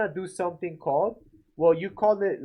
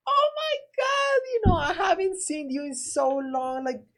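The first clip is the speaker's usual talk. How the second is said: fake overexcited friend; 10 semitones higher than usual. Shown as a demonstration. Pushy guy fakes joy meeting homeowner, high and hammed-up. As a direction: overdo it like a phony salesman. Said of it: "you don't wanna be that guy".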